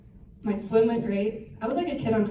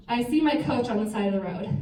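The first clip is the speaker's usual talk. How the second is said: ordinary delivery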